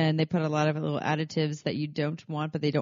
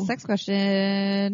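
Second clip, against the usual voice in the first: announcer; 4 semitones higher, much slower than usual. Pretend. Announcing the segment.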